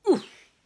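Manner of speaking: sharp winded oof, high and loud. A narrator's reading. Narrator voices the striker hitting the tummy, loud oof. Sound effect.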